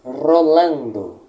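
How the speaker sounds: "rolando" with a long, strongly rolled trilled r. Shown as a demonstration. Teacher showing how the Latin R must roll, "rolando" trilled. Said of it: "ela deve ser lida rolando".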